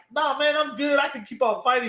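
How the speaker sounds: lightly acted, high-pitched protest; mock brave insistence. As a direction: imitate a hurt fighter waving off concern. Voicing injured fighter insisting he's fine.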